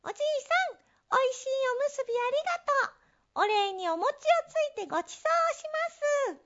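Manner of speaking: squeaky little mouse voice; high mouse voice. Acted. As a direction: speak high like a tiny mouse. Mice greet neighbour.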